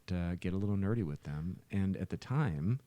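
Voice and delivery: ordinary talk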